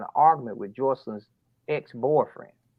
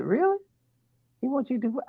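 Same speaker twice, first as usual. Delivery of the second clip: incredulous question; 12 semitones higher, 3 dB quieter, faster than usual. Retold or imitated. Father hears restraining order plan.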